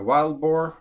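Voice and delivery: normal talking voice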